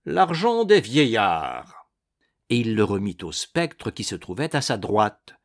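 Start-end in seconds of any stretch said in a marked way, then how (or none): 0.0-1.9 slow, hollow, carefully articulated; articulated ghost voice. A narrator's reading. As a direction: spell out each word like a ghost. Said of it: "il articula".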